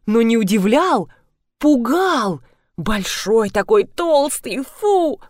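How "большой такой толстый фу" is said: disgust. Acted. Disgusted memory of the kombucha.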